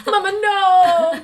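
slow, laughing fake cry; mock sobbing. Da Vinci crying to his mother, hammed-up. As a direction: wail it like a hammed-up son.

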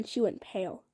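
usual speaking voice

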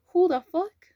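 high, loud startled swear; shocked outburst. Real, not acted. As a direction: blurt it out in real shock. Listener shocked by the hidden voice.

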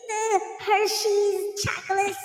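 high-pitched, over-eager mock menace; hammed-up threat. Snacker arming himself against a brownie, hammed-up threat. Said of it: "I be making threats".

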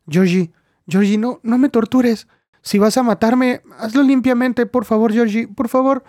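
desperate begging. Acted. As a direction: plead for a clean death.

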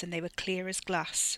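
plain reading voice

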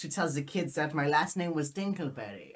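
put-on comic German accent. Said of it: "a full German accent".

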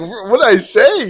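loud, high mock protest; indignant retold shout. Teller repeating his retort to the man, loudly indignant. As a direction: replay the shouted comeback. Acted comeback in yelling match.

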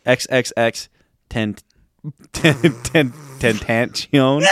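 halting "ten-, ten-" before a drawn-out "Tentacion"; struggling to pronounce. Real, not acted. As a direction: stutter your way into "Tentacion", with a "sh" in the middle. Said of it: "I don't know how to pronounce it".

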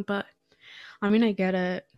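ordinary talk, nothing marked

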